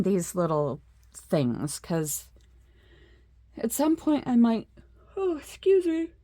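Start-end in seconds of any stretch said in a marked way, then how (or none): 3.6-6.1 yawning. Real, not acted. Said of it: "the yawning's starting".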